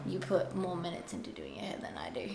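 soft unvoiced aside; whispering. Real, not acted. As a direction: whisper it as a tease.

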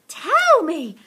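high, very loud demand; crying out. A narrator's reading. Missus Silver desperate for the secret, loud high cry. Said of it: "cried Missus Silver".

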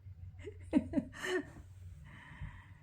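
breathy high-pitched giggle. Real, not acted. Laughing at the dog.

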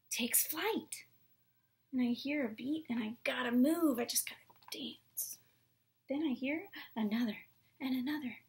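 lively picture book reading; reading to children. Acted. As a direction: read it with big expression.

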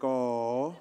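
drawn-out teasing of the runners; mock pity. East African teasing American marathon runners, mock pity. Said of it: "I'm like".

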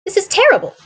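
mild shocked cry, pitched high. Acted. Watson reads the note, crying out in dismay. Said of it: "I cried".

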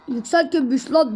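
tough guy quote. Retold or imitated. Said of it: "demiş".

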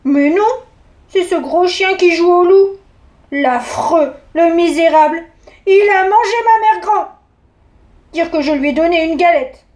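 put-on a girl scandalised by the wolf; indignant little girl.

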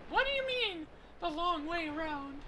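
falsetto daughter asking, puzzled; high girl voice. Acted. Daughter questioning her dad's plan, high falsetto. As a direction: lift into a girl's falsetto to question.

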